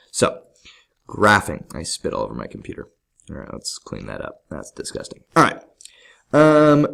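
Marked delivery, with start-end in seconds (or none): 1.7-5.2 fast, much softer mutter to self; muttered aside. Real, not acted. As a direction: mutter it under your breath. Aside muttered after spitting.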